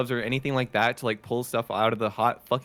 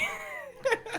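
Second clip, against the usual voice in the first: squeaky laugh; 7 dB quieter than usual. Real, not acted. Laughing at own story.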